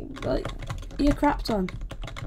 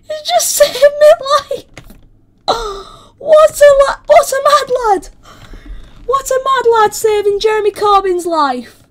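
very high, loud squeal with gasps; breathless high-pitched astonishment; squealing amazement; 13 semitones higher, 10 dB louder than usual. Real, not acted. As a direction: squeal in overjoyed disbelief. Amazed at being rescued.

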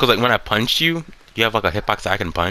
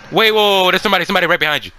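high, loud, fast alarm; urgent warning; 7 semitones higher, 4 dB louder, much faster than usual. Real, not acted. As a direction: rush to warn your teammate. Warning teammate of an enemy.

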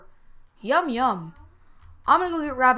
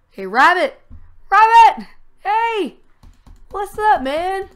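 loud high calls across the cave; shouting for Rabbit; 10 semitones higher, 12 dB louder, slower than usual. Real, not acted. Calling loudly to teammate Rabbit in the cave, shouting. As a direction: yell out to find your friend.

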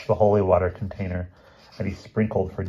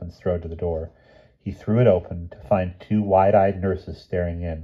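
ordinary delivery

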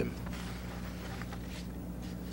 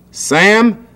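higher, louder sharp shout; father shouting; 5 semitones higher, 6 dB louder than usual. A narrator's reading. Father shouts at Sam off the typewriter. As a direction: bark at the boy to let go. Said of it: "shouted his father".

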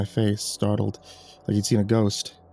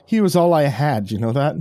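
raised, pained anger, dropping at the end; through gritted teeth; 5 semitones higher, 5 dB louder than usual. A narrator's reading. Bereaved child confronting the father's killer, gritted-teeth anger. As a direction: start high and loud through gritted teeth. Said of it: "through gritted teeth".

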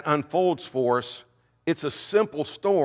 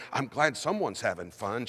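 grumbling; 5 semitones lower, 7 dB quieter than usual. Acted. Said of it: "she grunts".

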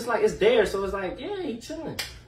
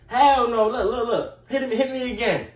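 mock dare; 6 semitones higher, 4 dB louder than usual. Daring her to hit again.

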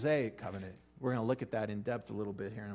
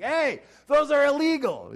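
much higher, much louder indignant shout; angry neighbor yell; 13 dB louder than usual. Retold or imitated. Neighbor shouting at the firework neighbor, high indignant yell.

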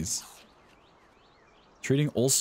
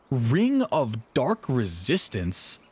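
ordinary talk, nothing marked

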